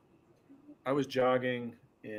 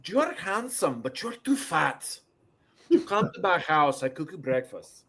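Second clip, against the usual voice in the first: higher-pitched mock Spanish accent; 4 semitones higher, 3 dB louder than usual. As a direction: imitate a fitness lady with a Spanish accent. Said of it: "in a Spanish accent".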